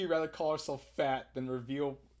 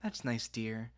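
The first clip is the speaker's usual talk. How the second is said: polite; 2 semitones lower, 6 dB quieter, slower than usual. Acted. Mom answering her child's friend, politely. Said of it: "give her usual polite".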